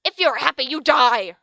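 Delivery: loud cackly threat; the teacher bellows a threat at the students; bellowed. A narrator's reading. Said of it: "her cackly voice bellowed".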